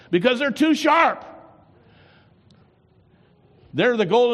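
high, loud preacher's warning; fiery preaching. Acted. Preaching at full force.

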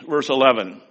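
ordinary talk, nothing marked

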